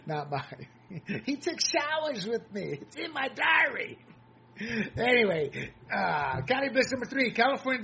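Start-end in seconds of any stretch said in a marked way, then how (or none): 1.2-4.0 high, slow mocking girlish voice, ending in a shout; hammed-up imitation of a diary confession; mock Ashley Biden. Mocking Ashley Biden's diary lines, high and shouted. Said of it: "That's Ashley Biden's words".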